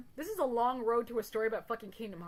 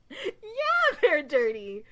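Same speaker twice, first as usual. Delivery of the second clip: excited agreement; 7 semitones higher, 9 dB louder, much slower than usual. Retold or imitated. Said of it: "he was like".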